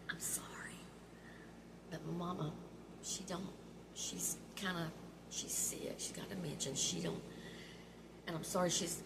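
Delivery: hushed whisper leaned toward the window. Retold or imitated. Daughter whispering to the drive-through girl about her mom, hushed. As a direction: whisper it so mama can't hear. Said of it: "I went like this toward".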